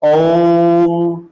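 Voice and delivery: ओम with the ओ held long and level; ओम को लंबा खींचकर; drawn-out ओम. Shown as a demonstration. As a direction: chant ओम, stretching the ओ. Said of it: "क्या उच्चारण करते हैं?".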